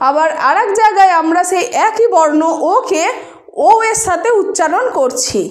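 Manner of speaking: ordinary talk, nothing marked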